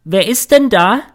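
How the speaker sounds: put-on high, loud call of an old blind woman; lautes Rufen. A narrator's reading. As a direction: call out sharply into the open, pitched right up. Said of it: "Plötzlich ruft die Alte". (lautes, hohes Rufen einer Alten)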